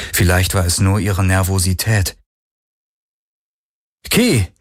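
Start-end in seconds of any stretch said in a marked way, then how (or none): 4.1-4.5 high, short call of a name. A narrator's reading. Voice from the ship calls to Kirai, calling out. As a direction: call to someone from inside. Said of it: "Die Stimme, die sie ... Schiff hörte".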